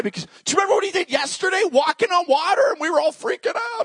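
high, rapid-fire amazement; acted breathless astonishment; excited disciple. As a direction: blurt out the wild memory, pitched high. Voices excited disciples recalling miracle.

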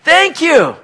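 high, loud cry of gratitude; exultant mock-captive jubilation; overjoyed thanks. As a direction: shout your thanks up to the Lord. Acting out overjoyed returned captives.

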